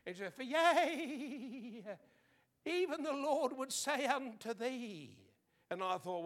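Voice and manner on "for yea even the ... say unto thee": imitating a quavering high prophetess voice; hammed-up Pentecostal prophecy; mock prophetess. Mimicking the faithful church woman prophesying, quavering. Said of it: "she'd quiver and shake and have the pro-, Pente-, Pentecostal shakes".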